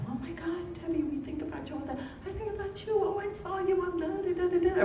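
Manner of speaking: put-on high gushing voice of old friends. Old friends gushing to Debbie at the party, high and excited.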